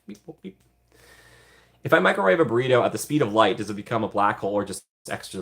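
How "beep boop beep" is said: high, quiet robot noises; mock robot beeping; robot beeps. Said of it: "you're sitting there like".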